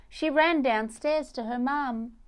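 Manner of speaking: ordinary delivery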